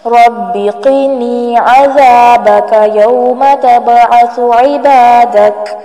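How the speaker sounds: slow, clear Arabic recitation of the dua; careful slow recitation. Shown as a demonstration. Teacher rereading the dua slowly for careful listeners. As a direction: recite it carefully so learners can follow. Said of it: "دوبارہ پڑھتی ہوں، دھیان سے سنیے".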